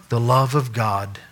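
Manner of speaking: ordinary delivery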